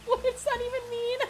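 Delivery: high-pitched, giggly disbelief; laughing bafflement. Real, not acted. Laughing after baffling quote.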